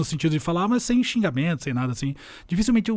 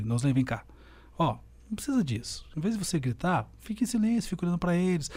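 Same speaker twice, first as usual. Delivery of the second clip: quiet advice; 8 dB quieter than usual. Retold or imitated. Older colleague quietly advising him in a corner, soft. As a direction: hand him the advice softly. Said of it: "ela me chamou assim".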